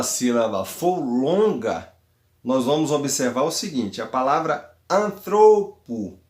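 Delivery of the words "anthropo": anthropo slowly, long loud THRO in the middle; stress on THRO. Shown as a demonstration. Teacher showing a long last syllable moves the accent, anthropo stressed on THRO. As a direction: lean hard on the THRO of anthropo. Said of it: "o acento vai recair sobre o ômega".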